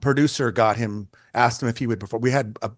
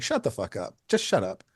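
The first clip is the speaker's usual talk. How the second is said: muted dismissal; 6 dB quieter than usual. Retold or imitated. Photographer brushing off being called a legend, quietly dismissive. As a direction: wave the praise away under the voice.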